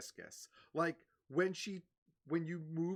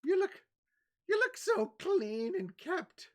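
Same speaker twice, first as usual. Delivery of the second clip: put-on charmed old lady; 8 semitones higher, 9 dB louder than usual. Old lady compliments the bard, sweetly doting. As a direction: coo over the clean young man. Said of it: "and she's like".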